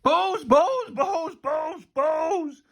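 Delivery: high, unhinged repetition to oneself; crazed muttering. A narrator's reading. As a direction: chant it as if going bananas. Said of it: "he began muttering to himself".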